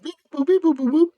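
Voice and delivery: very high chirpy beep-boop noises; robot beeps. Retold or imitated. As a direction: chirp the beeps like a tiny machine. Imitating gun installing patch.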